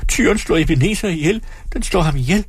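high, panicked cry, kept at reading volume; screamed cry for help. A narrator's reading. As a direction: raise the alarm in a high voice. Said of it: "skreg hun". (højt, panisk skrig, spillet behersket)

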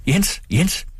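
high call across the yard; mother calling Jens, high. A narrator's reading. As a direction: call the stableman from afar. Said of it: "Hun råbte på rygteren".

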